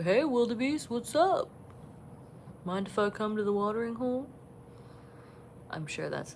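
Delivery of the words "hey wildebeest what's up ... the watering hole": high, quick cartoon animal voice; giraffe voice. Giraffe greeting the wildebeest at the watering hole, high playful animal voice. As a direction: voice the giraffe asking to join in. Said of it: "I always do the voices of the animals".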